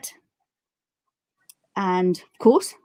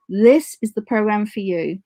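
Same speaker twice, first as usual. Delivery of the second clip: ordinary delivery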